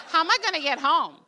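high, loud comic dismay; exasperated dismay. Retold or imitated. Wife stranded far from home, high exasperated dismay. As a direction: blurt it out as a retold panic. Stranded, retelling her own panicked thought.

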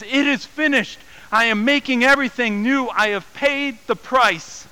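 Jesus crying out. Acted. Jesus on the cross crying out in triumph. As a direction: cry it out from the cross. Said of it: "as he's crying out".

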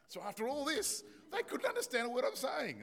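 high, loud laughing through the words. Real, not acted. Pastor laughing at how the family could not understand him. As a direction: laugh it out while telling the punchline.

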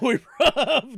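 put-on mangle a London accent on purpose; worst London accent. Mocking their UK listeners, laughing bad London accent. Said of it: "doing the worst accent of all time".

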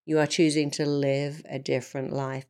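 ordinary talk

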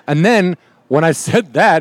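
ordinary talk, nothing marked

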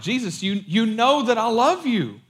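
imitated frustrated pleading. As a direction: act out Peter's frustration at being asked. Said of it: "He gets frustrated, and he's like".

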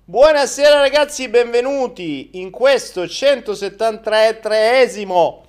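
high, loud showman welcome; excited host shout. Real, not acted. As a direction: boom the show opening like a TV host. Show intro, big host opening.

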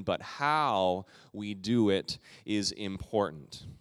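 but how we do it is important said very slowly; slow emphasis. Real, not acted. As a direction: land the point, stress on how. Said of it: "key point of sermon".

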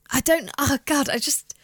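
ordinary talk, nothing marked

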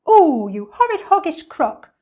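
high, loud monkey outburst; indignant storybook monkey; outraged cry. A narrator's reading. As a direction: cry out the insult in horror. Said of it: "cried Mugglewump".

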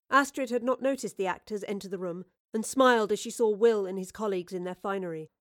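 ordinary delivery

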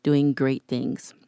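soft, earnest prayer voice. Acted. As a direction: pray it gently and sincerely. Closing prayer over listeners.

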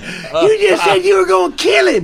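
high, loud mock accusation; shouted accusation. Dad retelling his accusation, shouting. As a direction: shout it through laughter.

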